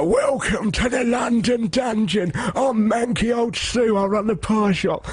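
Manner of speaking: high, slow theatrical crone caricature; hammy dungeon actor. Retold or imitated. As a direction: belt out an overacted spooky-attraction welcome. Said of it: "They're, like, rada trained".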